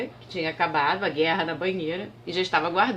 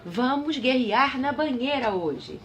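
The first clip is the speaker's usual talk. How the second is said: rei decidido; 5 semitones higher, slower than usual. A narrator's reading. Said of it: "o rei respondeu assim".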